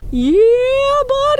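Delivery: very high, loud falsetto squeal; squealing falsetto yeah buddy. Pretend. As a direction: shriek it up high like a cheer. Catchphrase shouted in falsetto.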